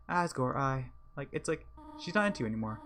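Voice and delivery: ordinary delivery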